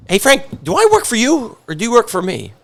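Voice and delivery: high-pitched, pointed boss's challenge; confronting grandfather. Retold or imitated. Grandfather to his delivery guy about who works for whom, confronting. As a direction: imitate a boss putting a worker on the spot. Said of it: "my favorite story is".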